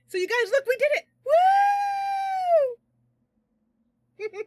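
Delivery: high, loud victory cheer; jubilant. Real, not acted. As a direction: shout the win to your audience. Celebrating success with viewers.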